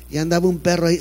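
ordinary talk

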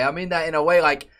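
normal talking voice

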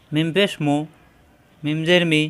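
usual speaking voice

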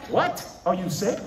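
high, loud incredulous outburst; mock shock. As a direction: voice a friend's stunned disbelief. Friends shocked he refuses drink.

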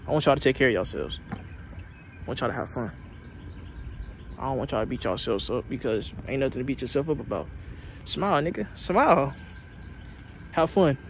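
loud, fired-up motivational speech; loud preaching pep talk. Real, not acted. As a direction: pump them up like a coach.

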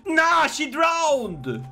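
high, drawn-out mock dismay; mock shock. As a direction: stretch it out in exaggerated disbelief. Mock exclamation at grim event text.

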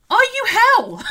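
high, loud, incredulous scoff breaking into a laugh; a hooting laugh of disbelief. Real, not acted. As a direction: laugh off the silly idea. Mocking scoff, then laughter.

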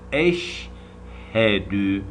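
eşhedü drawn out very slowly, each sound clear; slow recitation. Shown as a demonstration. Teacher leading pupils in slow recitation. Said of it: "Kelime-i şehadeti okutuyor bak şimdi".

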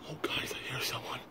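urgent hushed whisper; scared whisper. Real, not acted. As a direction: whisper fast, afraid of being caught. Hears someone while hiding.

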